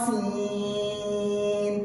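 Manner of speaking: slow, drawn-out melodic chant; Quran recitation. Shown as a demonstration. Teacher reciting letters for students, slow melodic chant. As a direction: stretch each letter name like tajweed recitation. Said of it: "तो".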